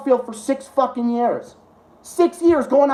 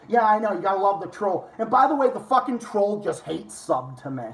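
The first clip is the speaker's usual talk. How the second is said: ordinary talk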